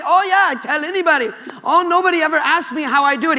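a preacher's hammed-up Indian accent; light comic Indian accent; mock Indian accent. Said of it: "I don't know if that's an Indian accent".

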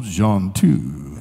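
low, slow John two with a rise; drawn-out preacher intoning. Retold or imitated. Preacher recalling the verse he wanted to say, low intoning. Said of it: "if I could just go".

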